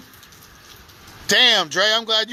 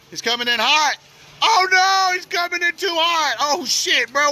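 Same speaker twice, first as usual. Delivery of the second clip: high, loud alarmed shout as the car rushes in; 10 semitones higher, 3 dB louder than usual. Real, not acted. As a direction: yell it in real alarm. Car speeding into flood water.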